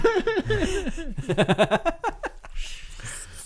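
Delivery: long, high-pitched real laughter; laughing fit. Real, not acted. Friend teased about paying attention, laughing hard.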